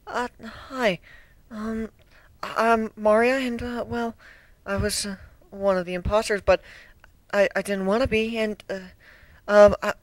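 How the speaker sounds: high, hesitant and timid; shy girl stammering through it; meek young griffin. A narrator's reading. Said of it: "the young Griffin said meekly".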